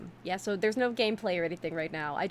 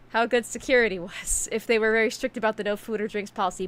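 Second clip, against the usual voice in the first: usual speaking voice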